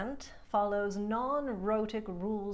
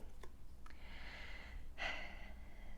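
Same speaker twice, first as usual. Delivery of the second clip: weary sigh; 13 dB quieter than usual. Real, not acted.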